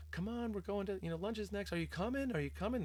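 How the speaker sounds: high-pitched imitation of kids urging a friend; pleading little friends. First-grade friends urging her to stay for lunch, high and insistent. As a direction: pitch it way up like eager schoolkids.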